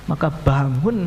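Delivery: ordinary talk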